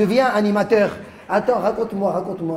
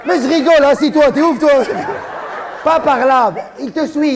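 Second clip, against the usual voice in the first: playful call; 7 semitones higher, 6 dB louder than usual. Real, not acted. Comedian teases, sends child off.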